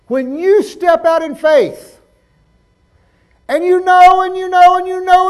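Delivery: high, loud preaching with drawn-out words; full-force preaching. Real, not acted. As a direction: preach it out big and slow.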